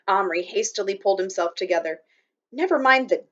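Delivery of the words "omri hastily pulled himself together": ordinary delivery, nothing marked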